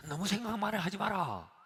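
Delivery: loud, high scolding advice; mimicking a pushy adviser, shouted high; mock loud advice. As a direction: bark the common advice at them. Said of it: "우리가 보통 그러죠".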